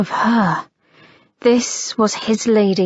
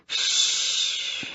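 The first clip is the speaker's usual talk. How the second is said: a soft unvoiced shh; hushing murmur; 9 dB quieter than usual. A narrator's reading. Harry hushing his lady against her mouth, whispered. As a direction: hush her gently. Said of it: "he murmured against her mouth".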